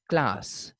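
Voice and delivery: "class" said KLAHS, long ah; British pronunciation. Shown as a demonstration. Teacher showing how British people say it, "class" with ah. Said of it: "with a sound".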